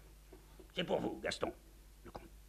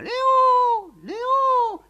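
very high screeching call; peacock cry; 16 dB louder, much slower than usual. Acted. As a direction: imitate a peacock shrieking the name. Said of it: "le paon crie lui aussi".

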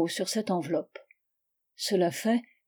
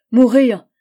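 cri désespéré; 3 semitones higher, 12 dB louder than usual. Acted. Charlotte fait face à Georges.

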